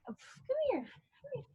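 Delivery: puppy talk. Real, not acted. Singer calls her puppy in a high soft coo. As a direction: coax the puppy over sweetly.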